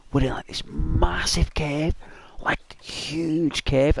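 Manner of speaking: hushed, slow whisper full of wonder; awed whisper. Real, not acted. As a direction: whisper it like you're back in that cave. Awed description of a dream.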